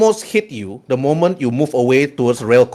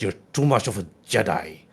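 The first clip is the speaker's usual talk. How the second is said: low, quiet villain reproach; scornful Sith; 4 semitones lower, 8 dB quieter than usual. Acted. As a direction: drop the voice for a soft jab. Haunt scorns Scourge in character.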